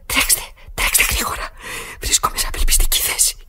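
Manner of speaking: frantic unvoiced whisper; barely audible desperate plea. Woman whispers to the operator, who can't hear her, desperate. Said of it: "Δεν σας ακούω, μαντάμ".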